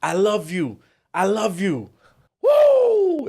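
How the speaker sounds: re-enacted high call, building to a big whoop; mock street shout rising into a loud high "woo"; retold joyful yell. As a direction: act out yelling love at passers-by. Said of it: "yelled at every single person".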